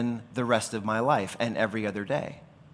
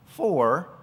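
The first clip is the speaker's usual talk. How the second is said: "for" repeated higher and drawn out; an emphatic, lifted "for" standing by itself; stress on "for"; 4 semitones higher than usual. Shown as a demonstration. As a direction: land on "for" alone, then pause. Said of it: "I emphasize that word".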